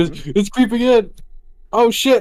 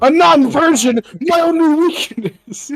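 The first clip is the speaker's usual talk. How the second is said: villain voice; 10 semitones higher, 5 dB louder than usual. Pretend. Player jokes in villain voice.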